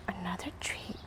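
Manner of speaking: whispered offer to a dog; whispering. Real, not acted.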